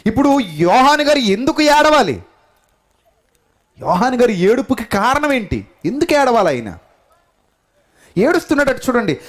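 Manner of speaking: high, loud pulpit cry; preacher asking the church why John weeps, impassioned preaching. Acted. Preacher dramatizes John's search.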